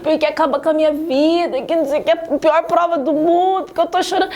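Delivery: fake crying. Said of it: "Para de fazer drama".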